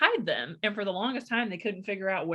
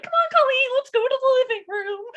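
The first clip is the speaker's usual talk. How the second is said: very high, loud, sing-song coaxing; sweet rescuing sister; 10 dB louder than usual. Retold or imitated. Sister coaxes little Colleen away from mom, very high and sing-song. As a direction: coax the kid away brightly. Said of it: "my sister would be like".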